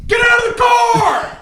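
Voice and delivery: high, slow, laughing mock yell; angry cop shout. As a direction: scream it like a hammed-up cop. Mock cop yelling at driver.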